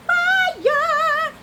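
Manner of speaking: high, loud outburst; excited cry. Real, not acted. Host swooning over blue eyes, crying out high and loud. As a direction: belt it out high.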